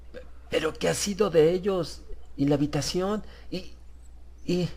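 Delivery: words failing him in fright; stammering fear. Acted. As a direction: Harris stammering to the stranger in panic. Harris stammers in panic.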